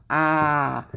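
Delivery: "Ah" held long and open; drawn-out demonstration of "Ah"; long vowel. Shown as a demonstration. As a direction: take your time letting "Ah" out. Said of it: "It takes time to get the sound out of your mouth".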